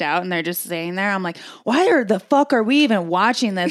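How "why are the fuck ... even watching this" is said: higher, exasperated fan rant; worked-up fight fan. Retold or imitated. Fan complaining about gassed-out fighters, worked up. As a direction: imitate a fan fed up with the fighters. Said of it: "I get way too psyched".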